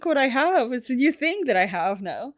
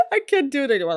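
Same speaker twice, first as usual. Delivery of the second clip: laughing; 3 semitones higher, much faster than usual. Real, not acted. Laughing after failed impression.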